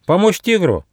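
high, indignant little squirrel; crying out in protest. Acted. Young squirrel balks at helping a predator, crying out. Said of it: "крикнул Бельчонок".

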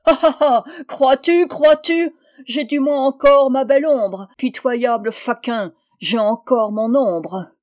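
loud, high, scornful gloating; frenzied disdain. A narrator's reading. Little man boasting of his shadow, frenzied disdain. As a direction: crow it in mock frenzy. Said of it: "en riant dédaigneusement et avec une sorte de frénésie".

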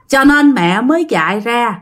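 ordinary delivery, nothing marked

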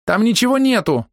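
high, insistent denial; high-pitched protesting woman; high-voiced woman. A narrator's reading. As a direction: deny it in a high female voice.

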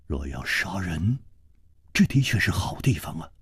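low, soft, near-whispered menace; quiet, sinister aside; ominous hush. A narrator's reading. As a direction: murmur it darkly into the silence. Ominous remark in silent dark tunnel.

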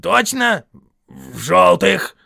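loud, high troll confirmation; Вильям. A narrator's reading.

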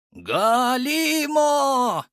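slow, high booming golem cry; golem roar. Acted. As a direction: stretch the name out like a giant. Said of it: "проорал составной голем".

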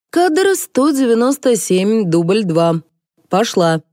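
opening high, then stepping down; routine call-out. A narrator's reading. Crew member calls take two on a film set, calling out.

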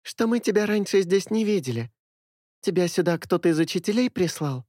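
put-on high bright girl voice; high ringing voice. A narrator's reading. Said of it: "продолжила высоким звонким голосом Миля". (высокий звонкий голос)

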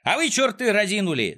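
high-pitched scolding holler; outraged villain shout; angry yell. A narrator's reading. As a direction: yell at idle workers. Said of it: "заорал Клопс".

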